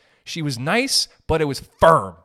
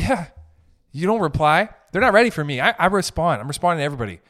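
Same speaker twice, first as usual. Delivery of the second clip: ordinary delivery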